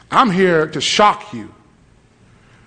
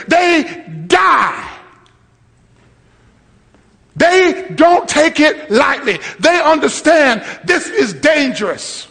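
high, shouted sermon delivery; impassioned preacher at the top of the voice; full-force preaching; 10 semitones higher than usual. Real, not acted. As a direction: hammer each phrase home to the congregation.

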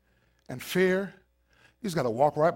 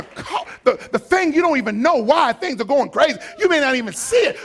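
ordinary delivery

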